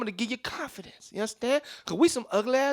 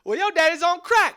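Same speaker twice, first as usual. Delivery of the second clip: put-on childish comeback; 7 semitones higher than usual. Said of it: "I was like".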